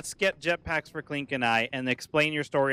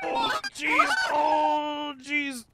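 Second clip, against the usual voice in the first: alarmed shout; 10 semitones higher, much slower than usual. Real, not acted. Panicked in game.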